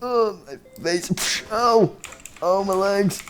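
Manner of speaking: high-pitched wail of escape turning to pain; acted cry. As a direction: wail high, like a hurt puppet. Puppet escapes, then gets hurt.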